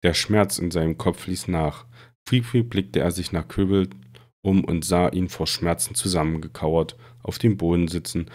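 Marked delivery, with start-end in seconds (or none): none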